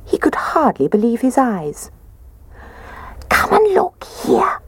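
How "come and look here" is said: hushed, slow, breathy little whisper. A narrator's reading. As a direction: whisper it so nobody wakes. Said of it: "he whispered".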